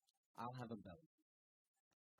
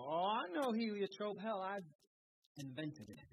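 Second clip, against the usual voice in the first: much higher, much louder, slow mock-proud exclaim; proud boast; 9 semitones higher, 10 dB louder than usual. Old husband boasting to his wife, loud and hammed-up. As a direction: crow it out like a proud know-it-all. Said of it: "And I'll go".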